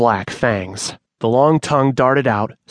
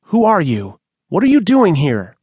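frightened demand; 7 semitones higher, 4 dB louder than usual. Acted. Manny faces the smoke shadow.

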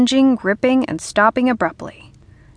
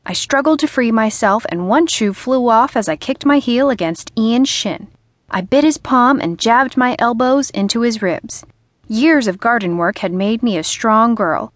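ordinary delivery

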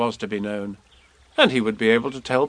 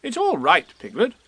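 raised, bustling rabbit voice; a busy, fussy rabbit asking; 9 semitones higher than usual. Acted.

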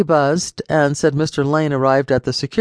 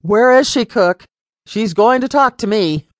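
high, loud furious demand; angry father demanding to see his daughter; 7 semitones higher, 4 dB louder than usual. A narrator's reading. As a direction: bark it through the house. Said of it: "never heard her father use that tone".

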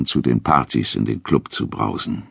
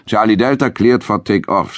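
tower controller over the radio, higher; crackly military radio; 8 semitones higher than usual. A narrator's reading. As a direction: call it through a radio headset. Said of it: "sagte er".

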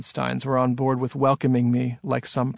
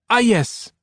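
put-on high French-accented interjection; suddenly reminded, in a French accent; 9 semitones higher, 3 dB louder than usual. A narrator's reading. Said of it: "in a French-sounding accent".

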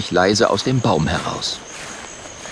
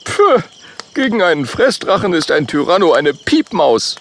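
higher dismissive voice; scornful Oskar; 8 semitones higher, 4 dB louder than usual. Acted. Oskar scoffs at the T-Rex, higher scornful voice. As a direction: brush it off with scorn. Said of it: "sagt Oskar".

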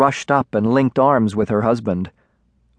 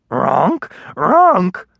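little creature's yowl, rising higher on the second cry; yowling dragonling; 7 semitones higher than usual. A narrator's reading. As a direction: yowl like a baby dragon in distress. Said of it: "the dragonling yowled".

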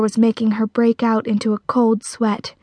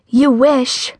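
louder, higher defiant retort; 4 semitones higher, 5 dB louder than usual. A narrator's reading. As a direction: throw it back defiantly. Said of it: "Patty flashed the spider a look of defiance".

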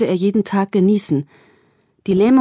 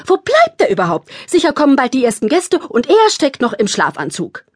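much higher, louder irritated complaint; 5 semitones higher, 3 dB louder than usual. A narrator's reading. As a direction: complain impatiently. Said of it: "Thekla drehte sich unwillig um".

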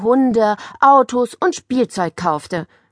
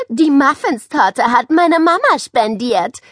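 proud, raised-voice announcement; calling out; 5 semitones higher, 5 dB louder than usual. A narrator's reading. Girl showing off mama's muffin cake, calling out. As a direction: call it out brightly to the class. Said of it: "rief sie".

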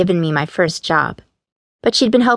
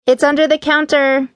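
higher, raised voice, mildly loud; said loudly; 9 semitones higher than usual. A narrator's reading. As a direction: call the answer back across the shop. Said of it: "I said loudly".

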